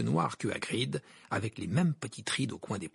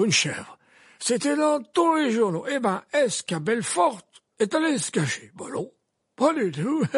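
put-on high, loud old wizard voice; gently probing old headmaster; Dumbledore voice; 10 semitones higher, 6 dB louder than usual. A narrator's reading. Dumbledore asks Hagrid about Hermione's shout, raised old wizard voice.

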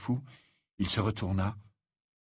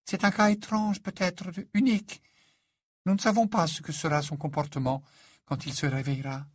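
lightly voiced character, higher than narration; careful, uncertain explanation, pitched up; grave Lupin; 8 semitones higher than usual. A narrator's reading. Lupin explaining the strange case to the parents, grave.

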